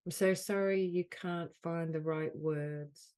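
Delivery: measured delivery, leaning on "so sorry"; guided healing affirmation. Acted. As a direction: offer each apology as a healing phrase. Leads healing phrases with long pauses.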